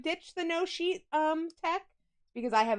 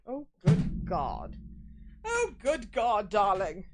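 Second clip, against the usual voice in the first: slow posh gasp; mock-posh, drawn out; a lightly posh lady's dismay; 3 semitones lower, 3 dB quieter, slower than usual. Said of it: "less, uh, posh?".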